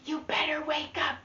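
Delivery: sister's urgent whisper; whispering in ear. Acted. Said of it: "spoke in my ear".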